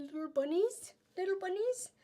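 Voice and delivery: high, sweet cooing over cute bunnies. Real, not acted. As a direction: coo it like something adorable. Cooing over bunny print.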